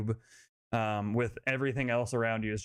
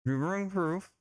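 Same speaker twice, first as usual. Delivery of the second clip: muffled voice; 6 semitones higher, slower than usual. Acted. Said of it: "You hear a muffled voice".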